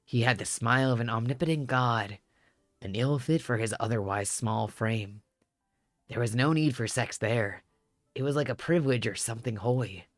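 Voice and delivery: low steady reminiscing voice; tall man narrating. Acted. Tall man tells memories.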